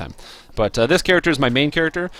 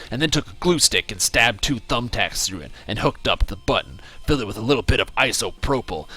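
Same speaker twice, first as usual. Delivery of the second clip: put-on American accent, choppy emphatic read; 3 semitones lower than usual. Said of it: "read this in his American voice".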